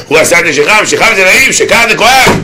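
mounting preacher's cadence; emphatic punchline. Real, not acted. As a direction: pitch climbing phrase by phrase. Preacher drives home punchline.

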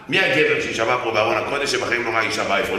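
ordinary delivery

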